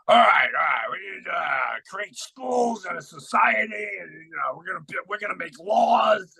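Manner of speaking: high shouted mockery of rule-makers; mock pompous shout. To his listeners, mocking the founders of society in a high bossy shout. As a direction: ham up a bossy leader laying down the law.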